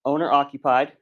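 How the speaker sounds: ordinary delivery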